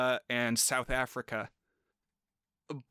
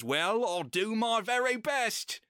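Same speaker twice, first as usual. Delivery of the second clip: higher, slow British butler imitation; British accent; 8 semitones higher, slower than usual. Butler answering the old lady in a mock British accent. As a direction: ham up a dutiful British butler's reply. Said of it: "do a British accent".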